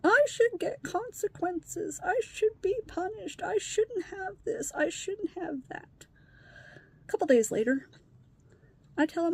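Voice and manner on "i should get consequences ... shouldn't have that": high, slow pity-party whine; imitated tearful sing-song; mock crying. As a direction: drag out each self-pitying phrase, pitched up. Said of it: "he starts crying".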